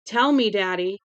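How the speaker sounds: loud, tearful pleading; tearful plea. A narrator's reading. Daughter begs her father on the phone, tearful and loud. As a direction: beg him through tears.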